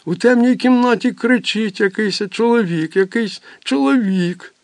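put-on high, urgent child's voice; frightened child. Boy telling mother about the voice, frightened. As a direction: tell it high and scared like a small boy.

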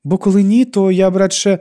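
високо й голосно, з легким надривом; говорити високо, ніби з хворого горла; надірваний голос. A narrator's reading. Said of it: "Її голос звучав надірваним". (надірваним голосом)

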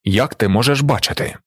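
plain reading voice

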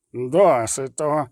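slow, firm anger; angry cry. A narrator's reading. Zoltan cuts off the priest, angrily. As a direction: put a stop to it with contained anger. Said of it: "крикнув гнівно Золтан".

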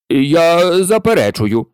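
a loud, slow objection; exclaimed. A narrator's reading. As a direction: shout the denial. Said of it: "вигукнув солоний заєць".

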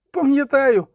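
high frightened cry of remembering; boy's cry. A narrator's reading. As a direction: blurt it out in a panic to avoid the whip. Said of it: "крикнув хлопець".

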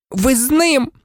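very high accusing cry; high outraged girl; horrified accusation. A narrator's reading. Hermione's horrified accusation of Lupin.

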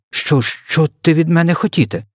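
high-pitched, flustered stammer; nervous stammer. A narrator's reading. Flustered giant asks the intruder's wishes, stammering. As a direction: stumble on the first word, voice up high. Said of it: "затинаючись".

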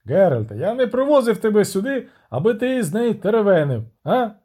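high, impatient princely rebuke; irritated scolding. Acted. Prince rebukes the witcher for chatting, irritated. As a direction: scold with the pitch pushed up.

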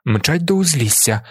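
ordinary delivery, nothing marked

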